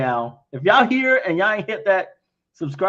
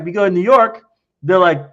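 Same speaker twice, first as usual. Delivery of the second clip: usual speaking voice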